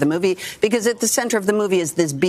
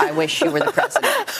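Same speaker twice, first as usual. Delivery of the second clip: laughing through the words, much higher. Real, not acted. Guest gushing to the host, laughing. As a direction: giggle it out, far higher pitch. Laughing at her own praise.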